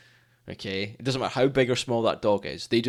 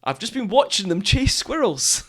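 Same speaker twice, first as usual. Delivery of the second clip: high indignant retort; 10 semitones higher, 3 dB louder than usual. Retold or imitated. Retort to walker's excuse.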